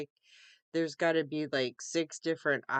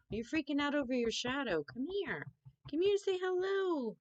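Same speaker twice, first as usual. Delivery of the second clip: calling the cat; 10 semitones higher than usual. Real, not acted. Calling her cat Miles to come, high coaxing pet voice. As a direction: coax the cat over in a high coo.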